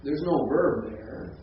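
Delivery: ordinary talk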